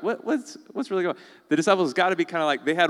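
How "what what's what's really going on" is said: mock-baffled question, pitched up high; a confused Bible reader scratching their head; pitched-up disbelief. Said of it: "you're like".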